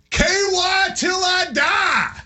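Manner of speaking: high, drawn-out yelled chant; imitated scream. Imitating a player rallying teammates, high screamed chant. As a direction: stretch each word in a high-pitched cry. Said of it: "and just screams out".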